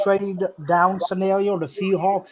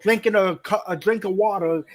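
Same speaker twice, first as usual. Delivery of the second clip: normal talking voice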